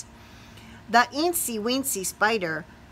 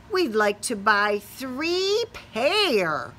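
slow mama; slower than usual. Acted. Mama to salesman.

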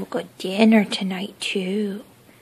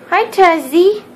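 higher louder sing-song; 8 semitones higher, 9 dB louder than usual. Real, not acted. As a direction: coo the name brighter. Greeting the dog.

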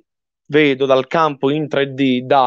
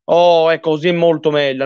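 ordinary talk, nothing marked

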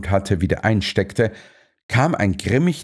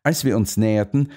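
ordinary delivery

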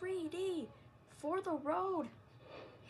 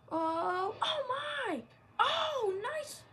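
high excited yelp; excited cry; 7 semitones higher, 5 dB louder, much slower than usual. Real, not acted. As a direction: burst out in surprise. Reacting to wrestling move.

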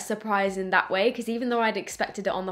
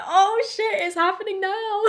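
high, startled gasp of realisation; retold shock; 9 semitones higher, 3 dB louder than usual. Turning to see her boyfriend kneel, high startled shock. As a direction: relive the moment it dawns on you. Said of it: "I turned around and was like".

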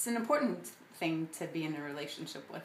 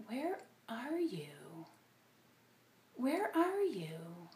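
tender calling; 3 semitones higher, much slower than usual. Retold or imitated. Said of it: "What if instead he was saying".

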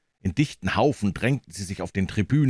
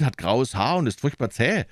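plain reading voice

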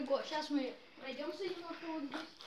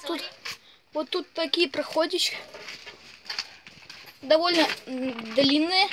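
echoing call; 10 dB louder than usual. Real, not acted. Shouting in an echoing ruin.

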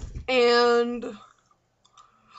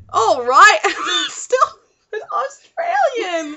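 badly faked British accent, coming out Australian; British accent attempt; 10 semitones higher, 9 dB louder, much slower than usual. Shown as a demonstration. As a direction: try British and slip into Australian. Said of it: "Can you pull up a British accent?".

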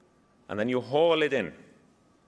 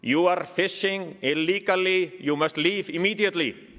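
put-on Icelandic accent; 3 semitones higher than usual. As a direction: shout a formal warning in a mock Icelandic accent, without much volume. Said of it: "and in Icelandic accent".

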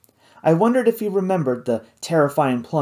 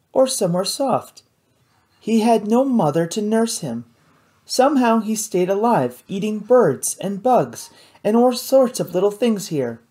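lightly voiced young woman, higher; higher, earnest girl's voice; 8 semitones higher than usual. A narrator's reading. Kate voiced in story.

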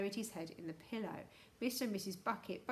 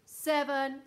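loud, raised, official count; booming countdown; 5 semitones higher, 10 dB louder than usual. A narrator's reading. Houston voice counts down to the freeze, booming. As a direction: call the number out like a loudspeaker. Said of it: "said the voice".

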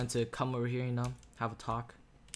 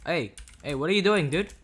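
calling out; 5 semitones higher, 10 dB louder than usual. Real, not acted. Calling out to a teammate.

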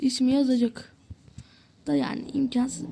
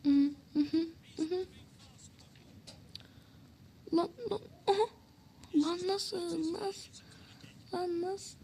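anguished groan; 5 semitones higher, 10 dB quieter than usual. Real, not acted. Groaning at the match.

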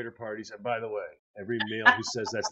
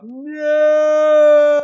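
loud, high-pitched cry of no; desperate parent's shout; yell; 13 semitones higher, 16 dB louder than usual. Retold or imitated. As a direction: shout it out in dismay. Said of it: "you just wanna yell out".

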